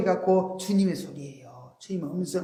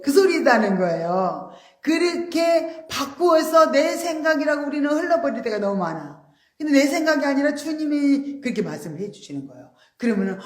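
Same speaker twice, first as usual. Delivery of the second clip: usual speaking voice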